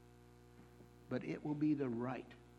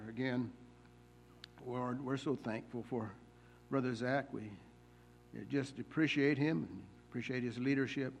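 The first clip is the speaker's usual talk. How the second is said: prayer; 4 semitones lower than usual. Acted. Leads a prayer.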